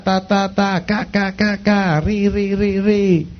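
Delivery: "ta", "ca", "ri" each repeated evenly on a level tone; syllable drill. Shown as a demonstration. Coach demonstrating diction exercises, "ta", "ca", "ri" in a steady repetitive drill. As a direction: chant "ta", "ca", "ri" as separate, evenly spaced beats. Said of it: "Que te pones a repetir".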